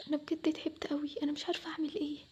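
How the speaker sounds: soft, defeated murmur; quiet despair. Acted. Daughter alone, tired of unfair treatment, quietly despairing. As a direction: let the voice sink, worn out.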